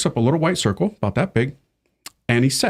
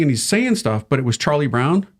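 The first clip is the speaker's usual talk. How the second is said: ordinary talk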